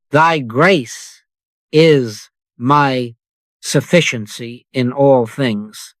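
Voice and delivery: Thy grace is my sufficiency spoken slowly with pauses between phrases. Shown as a demonstration. Repeating the refrain for the listener, slow with spaced pauses. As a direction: let each phrase of Thy grace is my sufficiency thin out into silence. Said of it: "Say it again, but slower, and let the syllables thin out".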